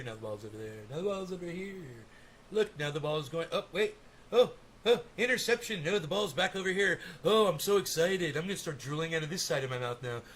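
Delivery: low, dopey mock sports fan gawking at the ball; mocking a drooling fan. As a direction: play a dazed fan drooling over the game, lightly voiced.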